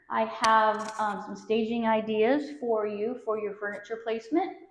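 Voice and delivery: normal talking voice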